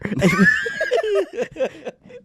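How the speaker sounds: loud breaking laugh; laughing outburst. Real, not acted. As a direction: burst out laughing mid-read. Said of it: "why do I sound like a vampire?".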